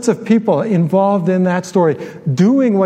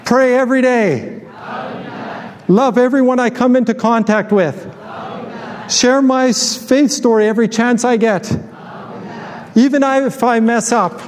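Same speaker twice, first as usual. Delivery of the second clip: higher, sustained call-out; pitched-up, chant-like pledge for a crowd to echo; declaration for repeating; 5 semitones higher than usual. Acted. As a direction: lead the congregation line by line. Leads congregation in repeated declaration.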